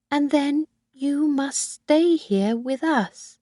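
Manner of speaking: put-on high, slow old woman's voice, firm and possessive; Missus Harvey, insistent. Old mother insists Kathy stay.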